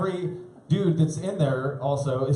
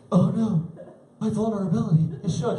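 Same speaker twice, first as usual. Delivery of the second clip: dismayed tough guy; 6 semitones higher than usual. Retold or imitated. Gangster dismayed.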